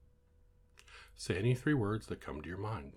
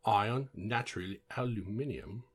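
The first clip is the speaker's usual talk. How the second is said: higher, badly faked English accent; shitty English accent; 3 semitones higher, 3 dB louder than usual. Shown as a demonstration. Trying the word list in other accents, mock English accent. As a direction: put on a bad English accent. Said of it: "That was my shitty English accent".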